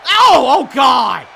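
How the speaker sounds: sudden loud yelp of shock; excited shout. Real, not acted. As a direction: yell out in disbelief. Excited wrestling play-by-play.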